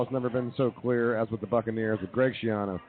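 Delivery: normal talking voice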